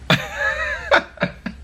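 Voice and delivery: high burst of laughter; laughing. Real, not acted. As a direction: crack up laughing. Laughs at joke entry.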